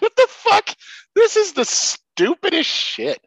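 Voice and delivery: high, loud, slow disbelief; mock disbelief. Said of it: "You're like".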